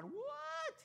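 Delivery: high, startled what; a sudden squeak of disbelief; mock-shocked shepherd. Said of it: "a shepherd would have gone".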